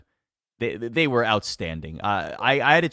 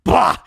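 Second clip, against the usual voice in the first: mock scoff; 8 semitones higher, 9 dB louder than usual. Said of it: "he's incredulous".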